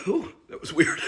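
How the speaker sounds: high, loud startled ooh. Real, not acted. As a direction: yelp in surprise, then explain. Startled by a big sneeze.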